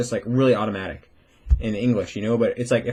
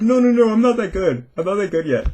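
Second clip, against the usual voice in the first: high, louder polite protest; mock humble no no no; modest denial; 9 semitones higher, 5 dB louder than usual. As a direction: insist you're not good, pitched up. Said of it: "I'd be like".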